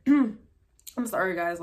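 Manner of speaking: hoarse, throat-clearing voice; frog in throat. Real, not acted. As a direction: speak through a frog in the throat. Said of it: "like, a frog in my throat".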